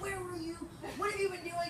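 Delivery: a retold scolding, lightly acted; mock angry demand. Friend scolding her for leaving the party, mock angry. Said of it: "he's so angry at me".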